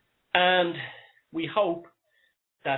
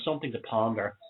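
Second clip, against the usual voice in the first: ordinary delivery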